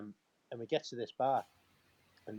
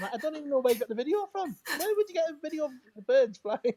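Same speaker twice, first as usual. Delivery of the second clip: high, loud, laughing disbelief; incredulous banter; 8 dB louder than usual. Real, not acted. Podcast guest ribbing his own story, incredulous. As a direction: voice shoots up, loud and tickled.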